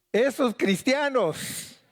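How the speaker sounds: high, drawn-out jeer; mocking name-calling. Preacher voices onlookers nicknaming believers, mocking taunt.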